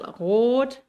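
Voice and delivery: long "rot" with R from the throat; German throat R. Shown as a demonstration. Teacher showing learners "rot" with a German throat R. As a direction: start "rot" at the back of the throat. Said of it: "el alemán sale de acá el sonido".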